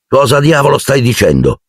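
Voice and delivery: an angry outburst, pitched high; angry exclamation. A narrator's reading. Harry confronting Malfoy, angry outburst. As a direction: fling the question at him angrily. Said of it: "esclamò Harry rabbiosamente".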